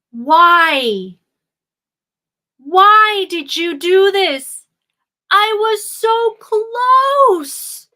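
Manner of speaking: loud, high, slow despairing cry; anguished cry. Acted. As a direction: wail it out like a man losing everything. Prince cries out in despair.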